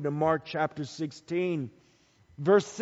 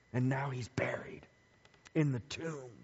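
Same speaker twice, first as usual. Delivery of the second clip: hushed whisper; 5 semitones lower, 7 dB quieter than usual. Acted. Hushed telling of Jesus buried.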